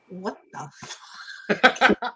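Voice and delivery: mock outrage at a cold reply; hushed what the, then blurting out the curse; stunned disbelief.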